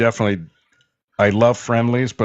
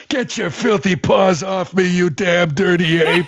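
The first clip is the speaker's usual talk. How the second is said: much higher, slow mock movie line; Planet of the Apes quote; 11 semitones higher, 3 dB louder, slower than usual. Joking about the line he meant to say to Heston, hammed-up. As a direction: ham it up like the astronaut snarling at the apes. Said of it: "I wanted to be funny".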